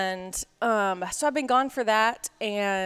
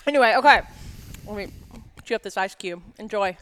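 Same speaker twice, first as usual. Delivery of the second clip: ordinary talk, nothing marked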